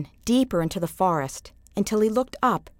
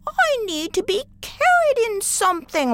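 the brook puzzling how to travel; water in the brook; 14 semitones higher than usual. A narrator's reading. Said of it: "asked the water in the brook".

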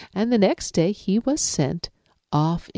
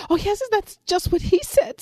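much higher, quick eager rabbit. Acted. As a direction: chirp it out all innocent. Rabbit tricks lion.

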